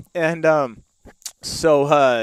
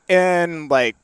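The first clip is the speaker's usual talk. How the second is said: ordinary delivery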